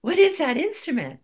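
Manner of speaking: high, loud gasp of wonder; in awe. Retold or imitated. Student asking the teacher, amazed and in awe. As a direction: marvel at it out loud. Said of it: "went up to him in awe".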